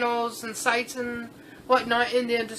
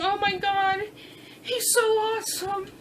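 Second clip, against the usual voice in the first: ordinary talk, nothing marked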